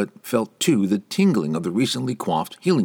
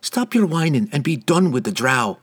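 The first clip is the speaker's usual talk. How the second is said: higher, sharp snarl back; 7 semitones higher than usual. A narrator's reading. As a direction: snap at him, annoyed. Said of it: "Canterbury snarled back".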